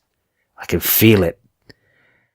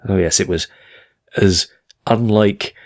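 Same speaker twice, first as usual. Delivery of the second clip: normal talking voice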